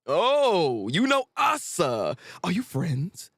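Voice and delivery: normal talking voice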